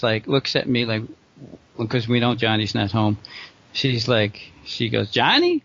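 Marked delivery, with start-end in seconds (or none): 5.1-5.6 calling out for Johnny. Retold or imitated. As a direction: call the son's name up high like a question. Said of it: "like that, you know?".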